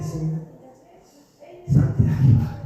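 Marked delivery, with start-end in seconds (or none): 1.7-2.6 breathy unvoiced whisper before the mirror; whispered to himself.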